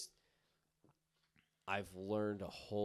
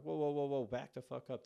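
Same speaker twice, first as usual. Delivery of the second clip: a high, louder retelling of the whoa; alarmed exclaim; 7 semitones higher, 5 dB louder than usual. Retold or imitated. Husband repeating what he told his angry wife, high and raised. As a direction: replay the protest, a bit raised. Retold reaction again.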